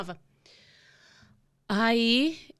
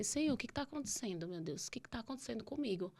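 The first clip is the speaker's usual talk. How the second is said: praying to God; 3 semitones higher, 9 dB quieter than usual. Retold or imitated. Patient in bed talking to God, hushed prayer. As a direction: pray softly, as if only God can hear. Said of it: "Eu conversando com Deus".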